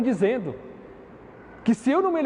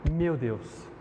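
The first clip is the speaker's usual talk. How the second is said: intimate; 6 semitones lower, 6 dB quieter than usual. Shown as a demonstration. Preacher savours Jesus' personal words, "Meu Deus" soft and low. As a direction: softly, lower and quieter. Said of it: "um termo muito pessoal à carta".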